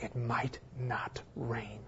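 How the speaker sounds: sudden whisper; hushed, intimate whisper. Real, not acted. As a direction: let it fall to a whisper. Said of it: "whispered ending".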